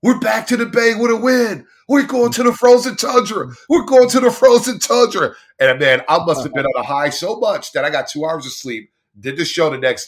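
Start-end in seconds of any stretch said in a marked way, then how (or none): 0.0-3.5 high, loud fan yell through the terminal; celebrating fan yelling through the airport terminal, high and loud. Retold or imitated. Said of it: "I'm just yelling". 3.7-5.3 high, loud repeat of the chant; celebrating fan yelling the line again, high and loud. Retold or imitated. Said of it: "I'm just yelling".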